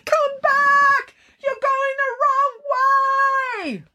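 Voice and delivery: very high, drawn-out yell. A narrator's reading. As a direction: yell after a bus from far away. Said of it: "as she yelled".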